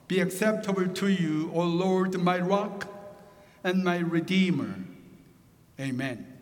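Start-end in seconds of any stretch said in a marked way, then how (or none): none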